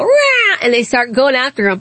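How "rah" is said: sudden high squawk Rah; bird screech. Retold or imitated. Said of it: "two birds just go".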